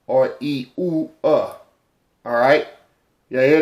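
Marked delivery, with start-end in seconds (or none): 0.4-1.5 "e, ooh, uh" as separate vowels; triphthong demo. Shown as a demonstration. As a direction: sound out each vowel in "e, ooh, uh". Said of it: "Those are triphthongs".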